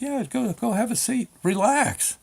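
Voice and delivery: high, softened clerk voice; reassuring clerk. Retold or imitated. DMV clerk sends a nervous man to sit, high-voiced and coaxing. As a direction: lift the pitch and coax him to settle.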